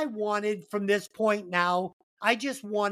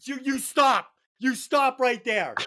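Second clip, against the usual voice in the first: ordinary talk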